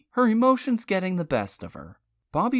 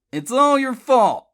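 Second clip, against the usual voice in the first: high, loud, furious; screamed blame; 7 semitones higher, 9 dB louder than usual. A narrator's reading. Charlie screams at Bobby with the gun, furious. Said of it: "Charlie screamed".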